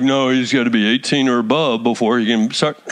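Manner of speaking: low imitation of a naysayer; mock objector. Preacher mimics adults who doubt young kids, in a low mock-objecting voice. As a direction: put on a low, doubting churchgoer voice. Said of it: "We're like".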